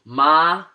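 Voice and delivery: MA held high and level; first tone. Shown as a demonstration. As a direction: say "ma" on a high, flat, sustained pitch. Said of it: "let me give you an example".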